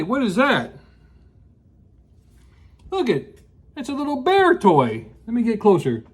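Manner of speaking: ordinary delivery, nothing marked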